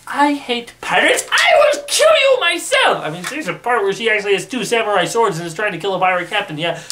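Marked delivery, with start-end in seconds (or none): 0.1-3.0 high put-on queen voice, exploding into a loud shriek; a hammed-up queen screaming her threat; mock furious queen. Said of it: "It's more along the lines of".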